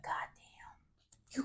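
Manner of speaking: whispered curse; panicked whisper. Retold or imitated. Realizing they're going to jail, whispered.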